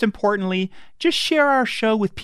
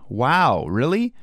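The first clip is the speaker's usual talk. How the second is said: ordinary talk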